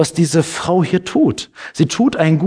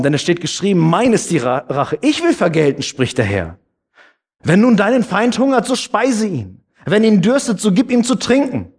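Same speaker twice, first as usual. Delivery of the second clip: raised, higher solemn reading of the Bible verse; scripture reading; 4 semitones higher than usual. Acted. Pastor to the congregation, reading Römer with raised solemn voice. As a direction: read it out to the congregation like proclaiming scripture.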